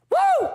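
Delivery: high, loud hype woo; loud celebratory whoop; excited woo. Real, not acted. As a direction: whoop it up for chat. Hyping chat about NFT.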